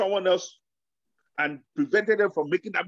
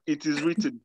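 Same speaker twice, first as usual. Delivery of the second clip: mock solemn Jesus; 7 semitones lower, 3 dB quieter than usual. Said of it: "You know what Jesus is saying?".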